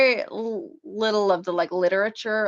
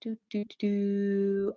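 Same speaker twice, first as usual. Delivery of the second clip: slow hum, softer, trailing off; softer humming noises; 7 dB quieter, much slower than usual. Real, not acted. Author still scrolling comments, humming quietly to herself. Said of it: "I'm making humming noises".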